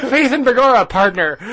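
mock Irish cowboy. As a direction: tease the mixed-up accent. Mocking the Irish-cowboy mix.

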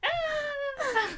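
high, squealed "Ah"; hammed-up little scream; mock shriek. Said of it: "Like that".

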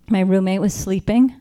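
ordinary delivery

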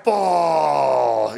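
long, drawn-out game announcer shout; stretched-out arcade announcer voice; Attack from Mars callout. Retold or imitated. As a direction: bellow it slowly like a pinball callout. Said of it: "with all its great call-outs".

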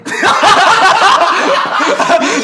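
high, loud laughing outburst; loud burst of laughter. Real, not acted. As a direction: crack up loudly. Big laugh at the problem.

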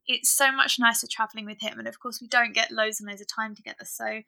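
ordinary talk

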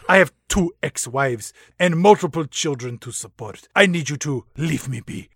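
pleading in a mock Latin accent; comic Latin-accented plea. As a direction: beg to be left alone, hammed-up Latin accent. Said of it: "my sexy Latin accent".

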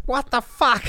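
high-pitched exasperated outburst, imitated; mad mechanic. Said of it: "He's so mad at me".

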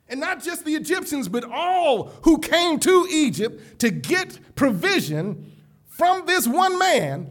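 loud, rising pulpit preaching; preaching at full force. Acted. Preacher lifting the congregation, fervent full-force preaching. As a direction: build the crowd up with each line.

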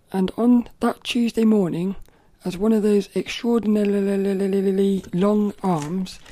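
put-on loud, slow read-aloud for children; storybook reading. Vlogger reading Mister Men book aloud, slow and loud. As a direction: read it out like to a room of kids.

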